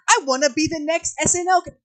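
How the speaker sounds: a hint of a comedian hoping for SNL; Jake Novak impression. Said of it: "I remind myself of, uh, Jake Novak".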